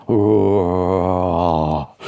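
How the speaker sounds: low drawn-out moan; mummy moan. A narrator's reading. Mummy on the radio moaning, low and slow. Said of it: "says the mummy".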